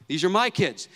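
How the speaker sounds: "my" punched as the key word; stress on MY. Shown as a demonstration. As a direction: claim them by stressing "my". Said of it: "with the emphasis on my".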